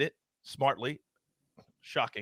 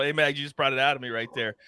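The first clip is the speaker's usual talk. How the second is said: ordinary talk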